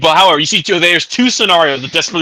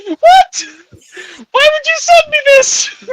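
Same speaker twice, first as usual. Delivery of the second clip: loud, very high squeal of disbelief; mock-horrified falsetto outcry; high-pitched outrage; 4 dB louder, slower than usual. Reacting in mock horror to something sent.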